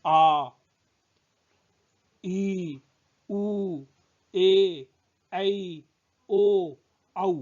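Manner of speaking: आ, ई, उ, ए, ऐ, ओ, औ each held twice as long; हर स्वर को लंबा खींचकर बोलो; long vowels drawn out. Shown as a demonstration. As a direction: stretch every vowel slowly, one by one. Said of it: "दो गुना समय लगता है".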